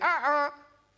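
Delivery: high puppet sounds through an apple; mouth full of apple. Acted. Puppet with apple in mouth answering the host, mumbled. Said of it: "kan du ikke ta ut det der eplet av munnen".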